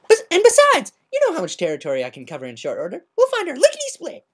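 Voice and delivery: cheerful boast to friends; perky Pinkie Pie, racing words; bright Pinkie. Acted. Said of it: "smiled brightly".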